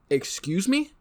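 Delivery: high, rising disbelief; incredulous. Retold or imitated. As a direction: act stunned by the price. Incredulous reaction to cheap gun.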